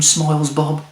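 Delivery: ordinary delivery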